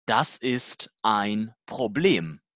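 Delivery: normal talking voice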